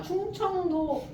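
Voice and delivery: drawn-out 충청도. Retold or imitated. Stretching province name.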